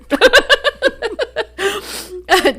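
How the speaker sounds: high squealing laughter. Real, not acted. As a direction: laugh helplessly. Laughing at own joke.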